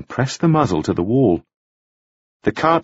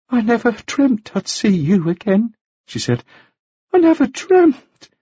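anxious woman voice; 12 semitones higher than usual. Acted. Margaret's voice continues.

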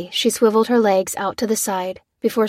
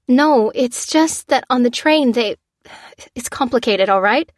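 flustered; 5 semitones higher, 4 dB louder than usual. Acted. Lucy flustered, defensive.

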